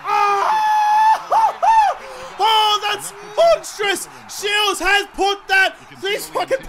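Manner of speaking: very high, loud, slowed yelling with a shrieking laugh; real elation at a long goal; ecstatic shouting. Real, not acted. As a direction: lose it over a monster kick. Huge goal from sixty metres.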